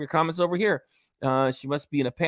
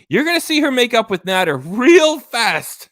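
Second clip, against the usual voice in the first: excited prediction; 8 semitones higher, 10 dB louder than usual. Real, not acted.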